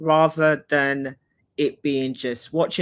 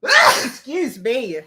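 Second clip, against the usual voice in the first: sneezing fit; 11 semitones higher, 7 dB louder, much slower than usual. Real, not acted. Sneezing fit after hay fever.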